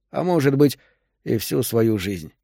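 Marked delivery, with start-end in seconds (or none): none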